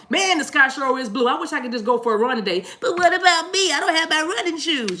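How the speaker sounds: high mock whine to her partner; mock self-centred girlfriend; needy whiny voice. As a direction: whine for attention. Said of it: "you were like".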